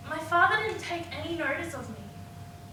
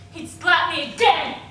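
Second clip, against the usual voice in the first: loud, rising on again; outraged outburst; 7 dB louder than usual. Acted. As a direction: hit the slap hard with the voice. Relives the slap, first time.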